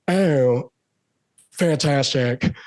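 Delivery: very slow, drawn-out star drawl; slow imitated celebrity approval; Nicolas Cage. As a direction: savor each syllable like an eccentric star. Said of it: "And he goes, he goes".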